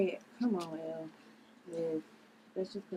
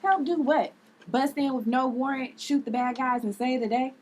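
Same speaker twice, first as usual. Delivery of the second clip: scolding sarcasm; 5 semitones higher, 5 dB louder than usual. Acted. Liv scolding Ella.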